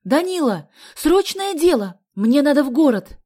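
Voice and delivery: high, loud urgent call; urgent shout. A narrator's reading. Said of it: "крикнула она".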